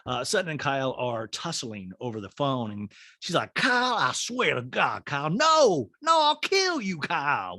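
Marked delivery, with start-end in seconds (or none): 3.5-7.6 heated threat climbing high on the last no; hammed-up frantic warning; plead, then threaten, pitch leaping up; very intense. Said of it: "which is very intense".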